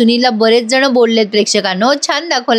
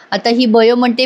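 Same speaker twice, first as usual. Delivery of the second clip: ordinary delivery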